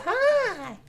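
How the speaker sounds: breathy-free, very high sweet "Hi"; Barbara Eden's greeting. Retold or imitated. Streamer quoting the actress's entrance, very high and sweet. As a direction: squeak out a high starlet hello. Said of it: "She goes".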